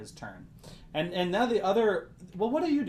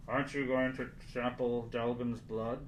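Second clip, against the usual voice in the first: softer, lightly voiced masked guard; masked cultist; 3 semitones lower, 5 dB quieter than usual. Masked cultist questioning someone leaving the line, lightly voiced. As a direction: lightly voice a cultist behind a mask, softly. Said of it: "from behind his leather mask".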